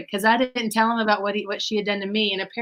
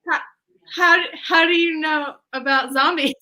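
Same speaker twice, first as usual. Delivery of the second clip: alarmed disbelief; 6 semitones higher, 4 dB louder than usual. Retold or imitated. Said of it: "And I went".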